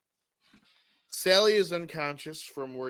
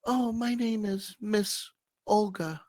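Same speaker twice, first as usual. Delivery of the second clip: put-on higher, lilting old lady voice; a prim old lady introducing herself; Miss Olga; 8 semitones higher than usual. Olga tells George her name in a lilting old lady voice.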